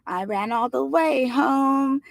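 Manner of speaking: high, very slow crooning falsetto; dreadful falsetto. Acted. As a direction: croon it like a bad doo-wop singer. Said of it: "in a dreadful little Anthony falsetto".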